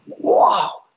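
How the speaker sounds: high, amazed retelling; imitating a thrilled new believer; excited convert. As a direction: gush like it's the best news ever. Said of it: "He was like".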